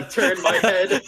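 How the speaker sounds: words through laughter; laughing. Real, not acted. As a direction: laugh while saying it. Laughs turning head slowly.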